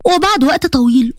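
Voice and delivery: ordinary delivery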